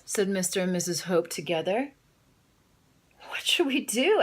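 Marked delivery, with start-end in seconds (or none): 3.3-4.2 worried question. Acted. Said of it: "asked Adeline".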